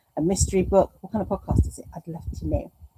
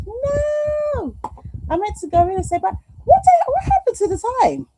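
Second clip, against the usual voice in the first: dismayed; 5 dB louder than usual. Real, not acted. Dismayed at missing Kelly.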